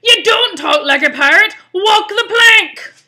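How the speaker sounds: loud, high outraged pirate yell; bellowing pirate. Acted. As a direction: order a failed recruit off the ship, bellowing. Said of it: "bellowed Pete".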